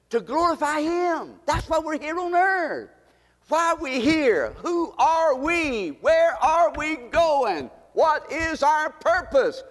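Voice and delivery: high, slow preaching cry; preaching at full force. Acted. Pastor crying out questions to the congregation, full preaching force.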